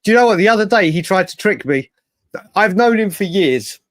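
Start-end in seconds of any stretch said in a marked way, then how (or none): none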